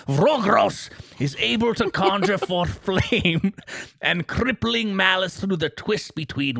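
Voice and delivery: put-on high, slow, strained old storyteller voice; Old Man Gillywinkle. Reading game lore to his co-host as a high, slow old man. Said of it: "Old Man Gillywinkle?".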